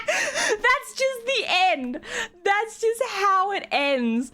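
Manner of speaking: high, drawn-out laughing squeal; incredulous and cracking up; helpless laughter. Real, not acted. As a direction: squeal it slowly through laughter. Laughing helplessly at the abrupt ending.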